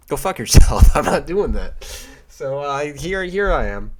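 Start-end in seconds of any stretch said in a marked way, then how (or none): 0.1-1.8 loud blurted curse, then a laugh; retold angry outburst. Retelling his answer to the Best Buy clerk, loud outburst. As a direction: snap it back at the clerk, loud. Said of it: "I'm just like".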